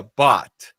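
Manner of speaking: "bot" cut short, stopping hard on the final T; clipped "bot" with an unreleased T ending; stop on the T. Shown as a demonstration. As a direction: say "bot" ending right on the T, no trailing vowel. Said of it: "Só para no T ali".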